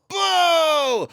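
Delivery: sudden high loud boo to scare; startling boo. Pretend. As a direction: jump out and shout boo. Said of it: "Did I scare you?".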